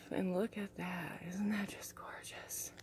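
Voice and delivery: quiet slow whisper to the viewers. Real, not acted. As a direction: let it fall to a hush. Whispering over the finished book.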